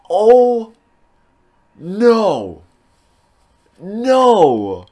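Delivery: high, stunned repeated cries; real disbelief. Real, not acted. Gamer reeling from the last pull, stunned disbelief. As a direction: cry each word out separately, high with shock.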